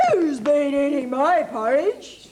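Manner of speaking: loud, slow big bear voice; Father Bear. Acted. Teacher reading to child as angry Father Bear, loud. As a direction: boom the question like a great big bear.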